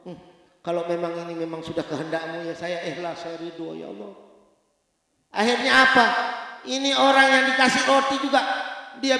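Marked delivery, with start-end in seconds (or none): 0.1-4.1 acted weeping mother, soft and low; fading, imitated tearful plea; tearful resignation. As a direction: surrender to God in a hushed, tearful prayer. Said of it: "Ibunya nangis".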